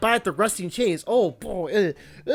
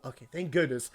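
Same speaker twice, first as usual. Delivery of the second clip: quiet relief; 8 semitones lower, 10 dB quieter than usual. Real, not acted. Quiet relief then loud.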